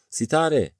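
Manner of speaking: high, loud call, falling at the end; shouted name. A narrator's reading. Narrator calls after the vanishing figure, shouted name. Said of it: "diye bağırdım".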